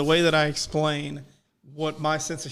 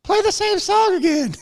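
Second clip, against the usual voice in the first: high squeaky puppet voice, much higher; 13 semitones higher, 5 dB louder than usual. Retold or imitated. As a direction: pitch way up like a silly puppet. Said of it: "And this puppet goes".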